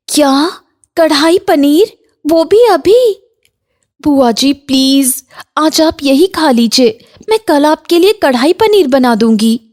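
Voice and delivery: high startled questions easing into a plea; dismayed daughter-in-law. Acted. Bahu asked for paneer now, high dismayed pleading.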